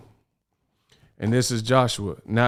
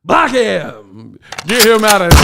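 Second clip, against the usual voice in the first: higher, louder shouted commands; mock ringside shout; 8 semitones higher, 9 dB louder than usual. Host pretending to stop the boxer's rant, loud higher shout. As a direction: yell like a cornerman stopping a fight.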